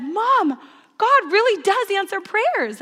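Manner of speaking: put-on high, loud child-to-mom exclamation; excited daughter. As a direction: voice a child amazed, pitched well up. Voices her excited young daughter.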